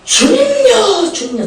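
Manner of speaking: high, drawn-out Gyeongsang cry to the Lord, then dropping; urgent Gyeongsang dialect. Acted. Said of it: "마음이 급하면은 막 경상도 말로 막 나옵니다". (다급하게 길게 부르는 경상도 사투리)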